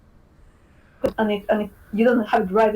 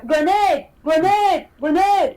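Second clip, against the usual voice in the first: high, repeated battle call; mock shouted grenade barks; game bark; 6 semitones higher, slower than usual. Shown as a demonstration. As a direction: call out a warning, same each time. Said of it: "you don't have the one grenade".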